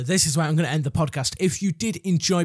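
usual speaking voice

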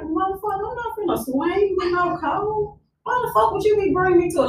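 high, indignant mock rant; retold attitude. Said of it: "I got a fucking attitude".

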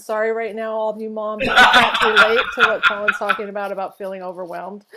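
sarcasm breaking into laughter; mock apology through laughs; extremely sarcastic. Real, not acted. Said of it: "I'm being extremely sarcastic".

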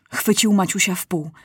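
ordinary delivery, nothing marked

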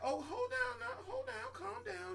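high shrill-pitched soothing, hammed up; mocking lady. Mocking a lady hushing a New Yorker, high-pitched. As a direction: imitate the lady telling her to calm down. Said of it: "And the lady was like".

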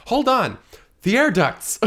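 high, loud sudden idea; excited idea. Acted. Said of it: "Misty's like".

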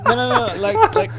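high, laughing protest. Real, not acted. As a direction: protest while cracking up. Laughing reply to teasing.

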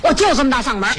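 defiant, unhushed loudness; big loud voice. Acted. Woman told to stop yelling, defiantly loud. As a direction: flaunt your big voice at him.

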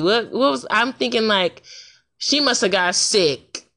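ordinary talk, nothing marked

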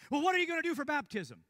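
loud, high, demanding challenge; aggressive demanding shout. Retold or imitated. A stranger grilling the preacher in his tent, loud and demanding. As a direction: fire the question at him like an accusation. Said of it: "that's how he was talking to me, in that tone".

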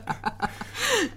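high nervous giggle; a high burst of nervous laughter. Real, not acted. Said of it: "c'est un rire nerveux".

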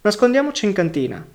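sudden outburst. A narrator's reading. Boy suddenly proposes hiding in the cellar, urgent outburst. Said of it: "Soltanto Dino a un certo punto saltò su".

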